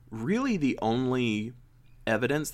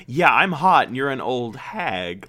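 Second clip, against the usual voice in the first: put-on bratty mean girl; 8 dB louder than usual. Mocking the petty princess.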